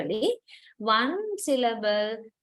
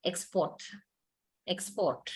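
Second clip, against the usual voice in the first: "export" with PORT more prominent than EX, low and soft; stress on second syllable; 4 semitones lower, 7 dB quieter than usual. Shown as a demonstration. Teacher confirms the usual way to students, "export" stressed on PORT. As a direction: give PORT the weight in "export", falling quietly. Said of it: "Po is much more prominent".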